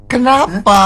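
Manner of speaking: high, puzzled acted question; wondering aloud. Radio host voicing a nagging question to the singer, wondering. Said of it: "Aku tuh bertanya-tanya jadinya".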